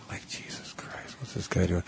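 hushed, breathy whisper to a co-host; whispered aside. Real, not acted. Whispering to the co-host about the strange guy on the bike. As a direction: whisper it under your breath, disbelieving.

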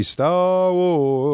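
slow crooning lounge singer. Retold or imitated. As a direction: croon it like a cheesy lounge act. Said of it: "the lounge singer".

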